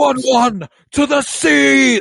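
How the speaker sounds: high, loud, drawn-out yell; mock battle yell. Podcaster quoting a favorite line, mock yell. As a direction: holler it long and high like a rallying cry.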